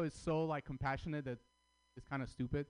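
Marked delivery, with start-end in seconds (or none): none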